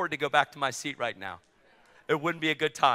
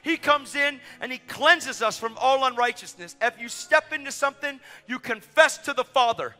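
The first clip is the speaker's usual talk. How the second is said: high, loud, driving sermon delivery; preaching at full force; 9 semitones higher, 7 dB louder than usual. Real, not acted. Preacher testifying of clean hearts, full-force preaching. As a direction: proclaim it to the whole congregation.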